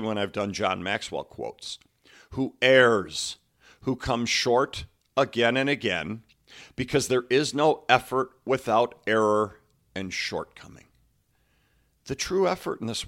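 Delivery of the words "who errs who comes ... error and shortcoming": forceful, deliberate recitation with pauses; emphatic quote reading. Acted. Coach recites on effort and shortcoming, emphatic quote reading. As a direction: punch the key words of the quote. Reads famous quote aloud.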